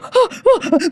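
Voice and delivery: sharp high gasp for air; high breathless gasp. Retold or imitated.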